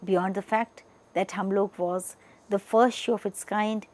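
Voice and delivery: normal talking voice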